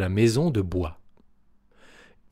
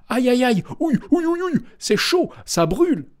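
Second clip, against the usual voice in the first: wolf burning; 13 semitones higher, faster than usual. A narrator's reading. The wolf falls into boiling soup, high pained yelps. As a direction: yelp as if scalded. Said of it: "disait le loup".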